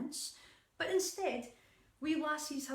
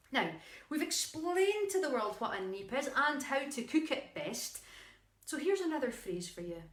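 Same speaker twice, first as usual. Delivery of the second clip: ordinary delivery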